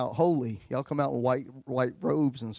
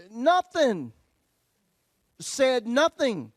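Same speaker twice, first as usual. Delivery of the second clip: nothing high and stretched slowly; high, slow stress on nothing; 9 semitones higher, 5 dB louder, much slower than usual. Real, not acted. Punchline emphasis on silence.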